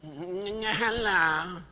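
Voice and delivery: a sweet timid greeting; Skulnik impression. Mentor's famous entrance line, lightly imitated. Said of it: "put on his little hat".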